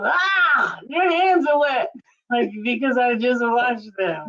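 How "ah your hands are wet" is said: high mock yelp at wet hands. Wife mocking husband's startled shout at wet hands, high yelp. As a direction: imitate his startled shout. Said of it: "you viscerally respond to".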